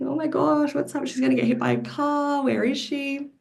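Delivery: high, anxious inner voice; frantic thoughts. Retold or imitated. Mother searching for her missing daughter, frantic and high. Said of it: "I was frantic".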